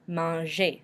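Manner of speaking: Manger as French mahn-ZHAY; silent final R. Shown as a demonstration. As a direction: say Manger ending in an AY, no R. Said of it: "it's said kind of like A".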